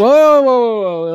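sudden loud parent whoa; calling out whoa. Retold or imitated. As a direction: call out to stop a running child. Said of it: "I was like".

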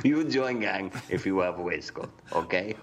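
put-on a light, playful Russian accent; mock Russian accent. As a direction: gang boss bit, in a hinted Russian accent. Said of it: "Why is he, why is he suddenly Russian?".

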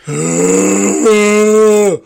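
loud, high drawn-out mock wail; imitated wailing sob. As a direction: bawl like a distraught friend, hammed up. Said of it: "and he's crying".

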